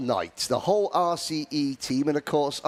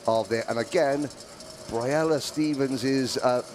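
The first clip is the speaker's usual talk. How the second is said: usual speaking voice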